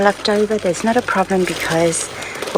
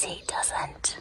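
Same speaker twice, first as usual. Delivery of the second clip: whispering on the phone; 15 dB quieter than usual. Real, not acted. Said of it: "I have to whisper".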